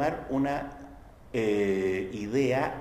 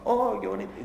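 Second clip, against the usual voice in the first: a quick, higher "Oh, qué bonito" in light mock wonder; admiring sigh; 7 semitones higher than usual. Lecturer repeating the sunset gazer's line, lightly mock-awed. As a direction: toss off the wonder in one breath.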